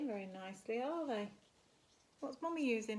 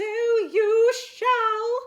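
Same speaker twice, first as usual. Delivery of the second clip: loud, very slow, high grand voice; mysterious godmother appearing, grand and loud; booming magical voice; 12 semitones higher, 18 dB louder than usual. Acted. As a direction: announce it like a fairy godmother. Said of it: "came a loud voice".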